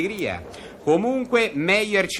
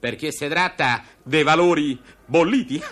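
ordinary delivery

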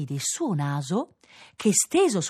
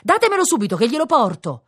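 louder, higher urgent demand; horrified urgency; 8 semitones higher, 6 dB louder than usual. A narrator's reading. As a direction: demand it at once, voice raised. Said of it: "esclamò inorridita". (richiesta concitata a voce alta)

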